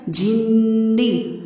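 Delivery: جنی with ghunna on the ن, kept short; very slow جنی with nasal ن; nasal noon. Shown as a demonstration. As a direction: nasalise the ن in جنی, don't stretch it. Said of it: "نون پر غنہ ہوگا لیکن نون کو لمبا نہیں".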